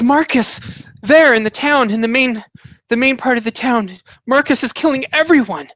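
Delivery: high, distraught survivor's report; crying, frantic woman. Acted. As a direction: gasp out the news in broken phrases, pitched high. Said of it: "she's crying".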